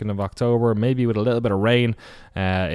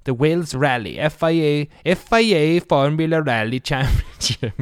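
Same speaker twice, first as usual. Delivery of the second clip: higher, hammed-up Welsh lilt; attempted Welsh accent; 5 semitones higher, 4 dB louder than usual. Host announcing the Wales rally, in a mock Welsh accent. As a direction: read it out in a dodgy Welsh accent. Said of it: "that's not a Welsh accent".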